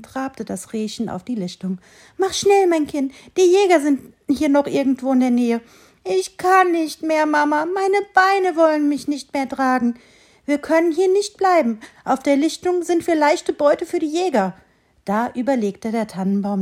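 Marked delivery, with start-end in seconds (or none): 2.2-5.6 urging mother doe. A narrator's reading. Mother doe urges fawn. 6.0-14.5 exhausted fawn. A narrator's reading.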